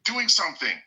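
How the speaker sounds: exasperated outburst. Retold or imitated. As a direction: throw it up high like you can't believe them. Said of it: "And, and say".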